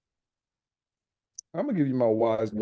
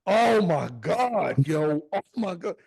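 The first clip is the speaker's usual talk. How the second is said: drawn-out, higher mock exasperation; exasperated aunt; 4 semitones higher, 4 dB louder, slower than usual. Aunt groaning at a long graduation ceremony, exasperated. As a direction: imitate an aunt who is fed up.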